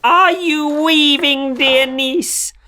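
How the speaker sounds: high, slow sing-song witch voice; high drawn-out crone calling through the window; witch asking sweetly. A narrator's reading. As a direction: coo it like a crafty old witch. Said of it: "She went to the window and asked".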